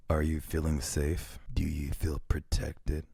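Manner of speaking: creepy driver voice. Retold or imitated. Streamer mocks the driver asking the rider, in a slow creepy voice. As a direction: drop the voice deep and drag it out like a creepy stranger.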